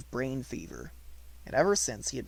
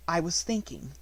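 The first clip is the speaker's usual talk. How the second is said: grand and polite; 4 semitones higher than usual. A narrator's reading. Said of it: "she answered grandly and politely".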